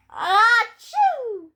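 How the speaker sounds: big, loud, high pretend sneeze; acted sneeze. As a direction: explode into a storybook "achoo". Character cannot stop sneezing.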